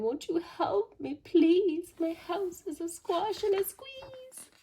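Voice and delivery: high, loud, slow desperate plea; very high loud pleading old lady; old lady begging. A narrator's reading. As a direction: beg at the top of her voice. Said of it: "And she said".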